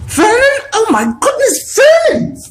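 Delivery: near-fainting wife cries out; shocked shriek. A narrator's reading. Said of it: "made a choking noise".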